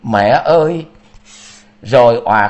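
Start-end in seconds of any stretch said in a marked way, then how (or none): 0.0-0.9 higher, louder anguished cry to mother; Vietnamese: gào lên gọi mẹ. Acted. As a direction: wail it out to her. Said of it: "gào lên".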